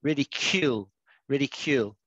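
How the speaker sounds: "Ridicule" with a clear Q sound, RID-i-kyool; teacher shows "ridicule" as rid-i-KYOOL; careful pronunciation. Shown as a demonstration. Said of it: "It's like a Q rather than a C".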